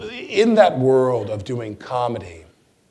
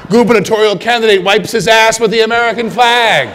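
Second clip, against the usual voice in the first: much higher, louder headline broken by laughter; laughing headline; 11 semitones higher, 12 dB louder than usual. Retold or imitated. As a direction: announce it while cracking up.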